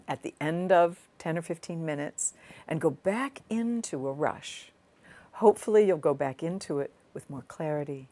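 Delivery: ordinary talk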